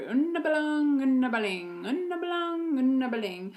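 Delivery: put-on high singsong toddler chant; toddler babble. Parent imitating toddler's repeated phrase, high chant. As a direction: chant it like a small child. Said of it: "saying it over and over".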